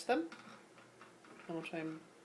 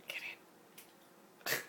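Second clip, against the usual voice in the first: ordinary talk, nothing marked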